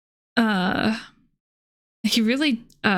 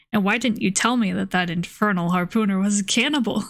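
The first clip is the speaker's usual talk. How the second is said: plain reading voice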